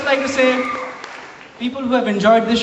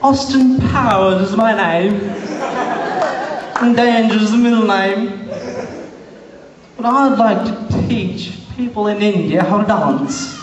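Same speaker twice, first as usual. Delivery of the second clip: swaggering spy parody, drawn-out delivery; slow, showy introduction in a comic British voice; Austin Powers. Acted. Austin Powers introducing himself at a visa interview, swaggering spoof voice. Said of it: "Mike Myers from Austin Powers".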